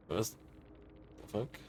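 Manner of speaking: whispered under the breath, slowly; stunned whisper. Real, not acted. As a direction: mutter it in disbelief. Stunned reaction to match result.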